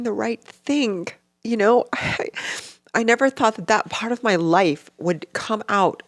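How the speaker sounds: real crying. Real, not acted. Mother hurt at being judged, really crying. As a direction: struggle through tears, with long breaks. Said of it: "I know you're crying".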